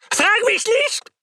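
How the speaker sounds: high, slow, desperate scream. A narrator's reading. Feverish Viktor screams at his worried friend, high terrified shriek. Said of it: "schrie ich".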